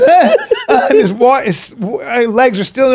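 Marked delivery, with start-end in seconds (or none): 0.0-0.9 high loud mock grunts; yelped grunts of hauling yourself out; straining grunts. Boy grabbing a root in the river, straining grunts. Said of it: "he goes".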